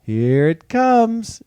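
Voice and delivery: high, singsong teasing, slowing at the end; teasing spring voice. Pretend. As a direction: play spring toying with everyone. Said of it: "spring's like".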